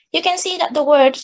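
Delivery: usual speaking voice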